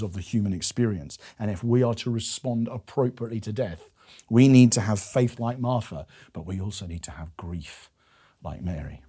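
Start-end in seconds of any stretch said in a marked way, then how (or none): 6.3-9.0 whispered, lower and soft. Real, not acted. Preacher urging grief like Mary's, whispered. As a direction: drop into a whisper.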